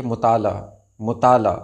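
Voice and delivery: मुताला ending in open "a", he unheard; silent he. Shown as a demonstration. Teacher showing how he sounds, final letter silent. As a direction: say "mu-taa-la" for मुताला. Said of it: "हे की आवाज़ इस तरह होती है".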